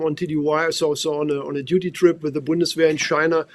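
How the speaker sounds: normal talking voice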